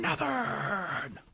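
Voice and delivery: high, soft, hammed-up echo; mock falsetto echo. Cohost joining the joke with a high, soft mock echo.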